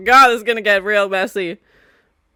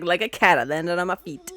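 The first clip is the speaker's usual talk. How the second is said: lower, smug and softer; smug hero voice; 6 semitones lower, 5 dB quieter, faster than usual. Retold or imitated. Voicing the character who just landed, lower and smug. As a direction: purr it out like a cocky cat. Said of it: "He's like".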